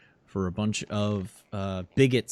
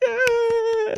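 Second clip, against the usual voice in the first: much higher crowd yell; crowd cheer. Acted. Rally crowd roars agreement, much higher. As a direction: roar agreement as a mob. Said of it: "everybody just".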